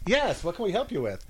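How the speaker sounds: high, soft, put-on clerk voice; mock store clerk. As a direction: answer the caller in a small high voice. Mock clerk answers the formal caller.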